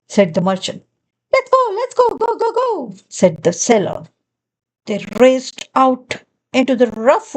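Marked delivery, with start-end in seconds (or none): 1.3-2.9 high, excited go go go; rally everyone out the door; eager hurry. Acted. Sailor rallying everyone to the boat, high and eager.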